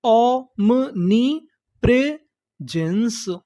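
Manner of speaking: "omnipresence" very slowly, with gaps between syllables; broken into syllables. Shown as a demonstration. Teacher building the last word, syllable by syllable. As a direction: sound out "omnipresence" in separate chunks. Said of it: "टुकड़ों में तोड़-तोड़ के पढ़ना है".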